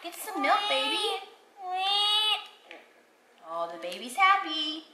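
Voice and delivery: high pretend mommy cooing. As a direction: play mommy to a baby, high and sweet. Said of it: "Okay, I'll be the mommy".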